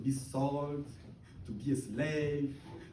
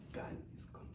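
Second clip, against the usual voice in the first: whispered, much softer; hushed whispered assurance; 14 dB quieter, much faster than usual. Real, not acted. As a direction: let the words fall to a breath. Repeat falls to whisper.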